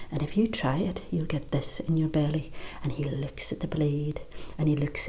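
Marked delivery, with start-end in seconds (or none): 0.1-2.5 menacing whisper with a knife shown; whispered threat. Acted. Woman threatening the bully with a knife, whispered menace.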